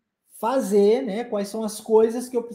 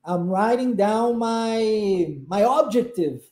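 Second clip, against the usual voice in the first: very slow model sentence; much slower than usual. Shown as a demonstration. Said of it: "trocando a última palavra".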